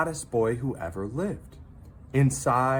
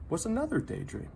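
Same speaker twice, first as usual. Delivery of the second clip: plain reading voice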